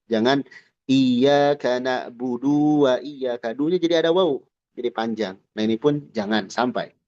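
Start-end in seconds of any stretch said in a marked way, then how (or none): 0.9-3.5 slow, na'budu with the final u stretched long; wrong long vowel. Shown as a demonstration. Teacher shows an overlong vowel to avoid. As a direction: stretch the u of na'budu too far. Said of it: "jangan sampai jadi ada mad".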